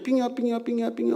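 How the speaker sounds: high sing-song cop call. Retold or imitated. Pastor imitating the American traffic cop beckoning, high sing-song. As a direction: wave the driver over with a high chant.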